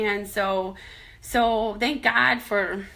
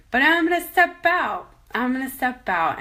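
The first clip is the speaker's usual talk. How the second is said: determined; 5 semitones higher, 5 dB louder than usual. Real, not acted. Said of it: "I guess I'm like that donkey".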